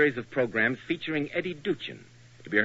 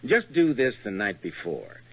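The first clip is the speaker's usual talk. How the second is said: radio ad read; 3 semitones lower than usual. Acted. Announcer reads the shampoo ad.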